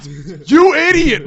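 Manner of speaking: high, loud outburst; mock outrage.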